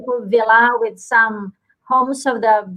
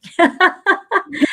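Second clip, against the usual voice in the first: laugh; 6 semitones higher than usual. Real, not acted. Host laughs about separation.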